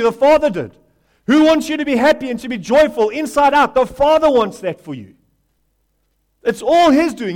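loud, high, driving call and response; fiery pulpit preaching; preaching at full force. Acted. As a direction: preach it hard, rising with each question.

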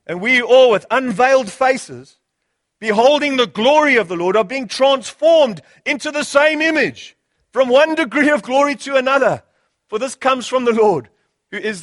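ringing, emphatic Bible reading; stately, impassioned pulpit reading; declaimed scripture. Real, not acted. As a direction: proclaim the verse with rising fervour.

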